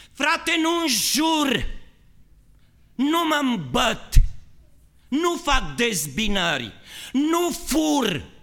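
high, loud shouting self-righteous brother; boastful churchgoer. Retold or imitated. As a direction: brag loudly of your virtues. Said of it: "strig la soție destul de des". (fratele lăudăros, strigând tare și sus)

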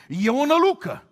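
high, loud panicked shout; frightened cry. Acted. Disciples crying out at a ghost, frightened. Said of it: "de frică au țipat".

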